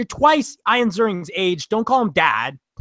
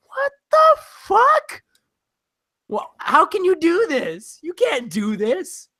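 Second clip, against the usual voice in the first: high, slow disbelief, voice cracking up; mock outrage at a cheesy product placement; incredulous; 10 semitones higher, slower than usual. Incredulous reaction acted out.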